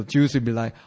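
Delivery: ordinary delivery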